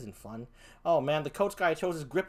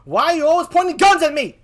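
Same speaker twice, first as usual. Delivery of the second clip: high, loud upset rant; hammed-up frightened outrage; indignant scolding; 9 semitones higher, 8 dB louder than usual. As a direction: tell them off, rattled. Scolds people pointing guns.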